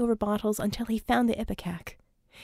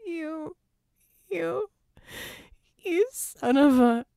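high, slow wailing, swelling louder; desperate wail; 8 semitones higher, 3 dB louder, slower than usual. A narrator's reading. Vicky wailing at Red in despair, high and slow. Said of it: "It was a desperate wail".

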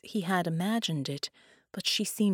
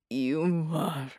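croaked, barely audible; croaking; 2 semitones lower than usual. Acted. Dying man to captor, a croak. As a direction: rasp it out with the last of his strength. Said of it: "Bela croaked".

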